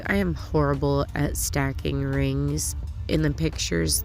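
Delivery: ordinary talk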